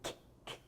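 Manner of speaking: Q-, q- as voiceless K bursts; teacher isolating K in quero; isolated K sound. Shown as a demonstration. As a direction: clip the Q to a bare K. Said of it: "tem som de K".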